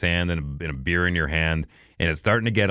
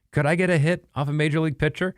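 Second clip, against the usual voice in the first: higher, wondering voice; quoting Ty; 8 semitones higher than usual. Retold or imitated. Podcast host voicing the YouTuber's question, lightly wondering. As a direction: ask it like a curious amateur. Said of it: "he had the question of".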